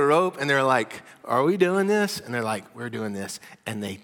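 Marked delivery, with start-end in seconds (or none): 1.2-2.2 high, loud, incredulous question; eager questioning. Acted. Friends on the roof checking with each other, eager question. 2.8-3.4 matter-of-fact resolve. Acted. Friends on the roof committing to the plan, understated resolve. Friends' decided answer to the question.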